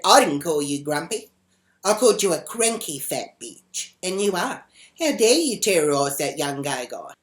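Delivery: loud, indignant re-enacted comeback; defiant retort. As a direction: fire back loudly in a retold row. Teller retorts in acted quarrel.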